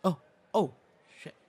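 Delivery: high, loud startled gasp of words. Acted. Spotting her partner at the mall, startled. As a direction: caught off guard, blurt it.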